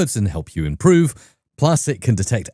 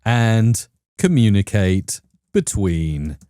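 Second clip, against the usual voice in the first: very slow, lower, word by word; typing aloud; 4 semitones lower, much slower than usual. Real, not acted. Host types a prompt for Claude Code, slow word-by-word delivery. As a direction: read out slowly as you type, with pauses.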